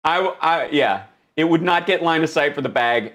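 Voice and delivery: normal talking voice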